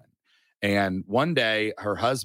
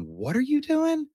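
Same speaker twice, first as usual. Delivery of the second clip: higher, slow, baffled asking; incredulous question; slower than usual. Retold or imitated. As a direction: play the puzzled churchgoer who can't believe it. Townsfolk questioning them for weeks.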